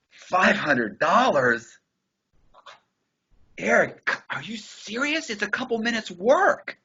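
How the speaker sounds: high, slow incredulous repeat of five hundred dollars; mock disbelief. Mocking Eric's price back at him in high slow disbelief. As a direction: stretch out the disbelief, pitch climbing.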